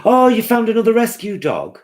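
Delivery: light up at the news; excited. Real, not acted. Vlogger thrilled at a viewer's rescue dog.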